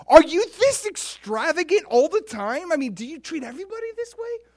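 very high, incredulous questions; blown away. Acted. Paraphrasing David to God, high-pitched astonishment. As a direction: ask as if floored by the extravagance. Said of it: "he's just blown away".

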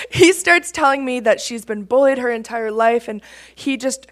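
normal talking voice

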